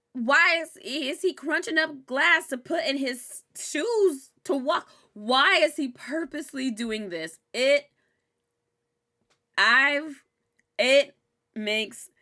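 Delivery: loud, high, slow squirming rant; disgusted disbelief. Real, not acted. Vlogger squirming at the glass scene, loud disgusted rant. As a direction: recoil as you ask it.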